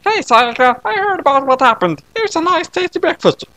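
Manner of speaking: put-on sugary high girl serving breakfast; high friendly girl bringing breakfast in bed; sweet Kyoko voice. Kyoko character voice.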